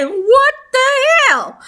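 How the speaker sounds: stunned disbelief, voice jumping up; shocked. Real, not acted. Shocked reaction to plot twist.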